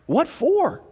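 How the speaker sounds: high-pitched, incredulous question. Real, not acted. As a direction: ask it in sheer disbelief. Mocking churches that rewrite mission statements.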